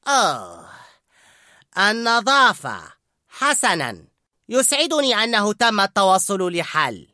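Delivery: slow, high forced pleasantness; fake smile. A narrator's reading. Skinner calming down with a fake smile. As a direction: smile through it falsely. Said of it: "بابتسامة مصطنعة".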